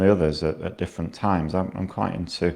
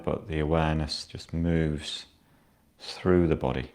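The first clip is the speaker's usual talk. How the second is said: guided meditation. Acted. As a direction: ease the listener down into stillness. Guides a body scan.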